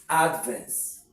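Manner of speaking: Advance as a noun, weight on AD; stress on first syllable. Shown as a demonstration. Teacher repeating the noun for students, first-syllable stress on Advance. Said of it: "as a noun".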